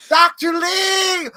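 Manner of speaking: high, loud, long-drawn cry; frantic mom shouting. Retold or imitated. Mom bursting into the vet's, frantic shout. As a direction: wail the name out slowly at full volume. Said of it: "Mom was in there, and she's like".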